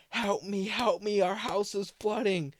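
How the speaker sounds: imitating mom begging the neighbors; desperate plea. Imitating mom begging the neighbors across the street for help.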